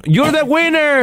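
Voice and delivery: slow, high announcer call. Retold or imitated. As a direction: announce the winner to the whole stadium. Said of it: "que le dicen". (anunciador lento y agudo)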